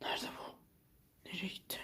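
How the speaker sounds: hushed, searching in the dark; whisper, looking around anxiously; nervous whisper. Real, not acted. Searching for the hidden monster.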